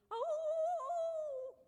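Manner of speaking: high loud sung howl; coyote howl. Retold or imitated. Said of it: "I hear in the canyon".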